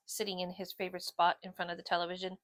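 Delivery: plain reading voice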